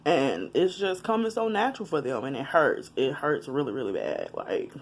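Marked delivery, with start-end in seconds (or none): 3.0-4.7 fading low and soft, near tears; real sadness she is fighting down; holding back tears. Real, not acted. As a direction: let the voice drop as the tears come. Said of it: "I'm trying not to cry in this video".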